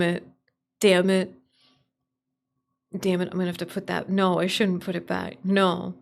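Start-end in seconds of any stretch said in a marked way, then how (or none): none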